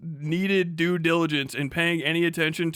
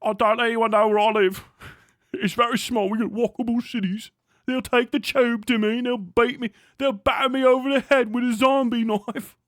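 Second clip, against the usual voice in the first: higher, louder put-on British whine; mock Brit; 5 semitones higher, 4 dB louder than usual. Mocking British viewers about knife crime, put-on whiny British voice. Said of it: "You're like".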